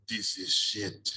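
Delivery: slow, low, flat verdict; imitating the old teacher. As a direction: drag out the dismissal like a weary master. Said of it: "And he goes".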